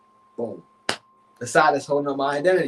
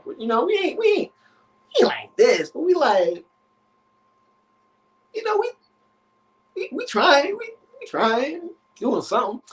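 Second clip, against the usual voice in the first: flustered; 10 semitones higher than usual. Real, not acted. Flustered justifying himself.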